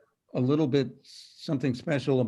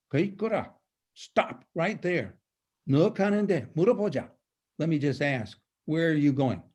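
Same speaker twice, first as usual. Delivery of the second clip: dramatic, abrupt command in a higher pitch; 4 semitones higher, much faster than usual. Acted. Stopping a monk like a police encounter, abrupt. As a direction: challenge him like police stopping someone. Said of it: "it's very abrupt".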